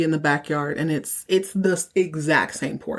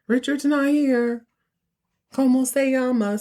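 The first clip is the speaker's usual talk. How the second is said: a badly faked Spanglish accent; fake Spanglish accent; 7 semitones higher than usual. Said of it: "put on a fake Spanglish accent".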